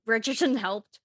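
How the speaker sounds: normal talking voice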